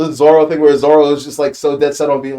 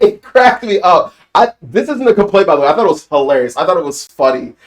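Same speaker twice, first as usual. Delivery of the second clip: normal talking voice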